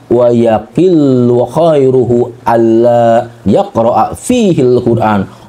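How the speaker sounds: slow, low scripture recital; drawn-out, solemn Arabic quotation; Arabic recitation. Real, not acted.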